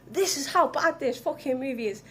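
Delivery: usual speaking voice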